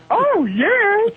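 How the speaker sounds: very high, drawn-out ghoulish wail; put-on piercing falsetto catchphrase; Paul Bearer. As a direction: swoop up into an eerie character cry. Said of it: "the most of Paul Bearer".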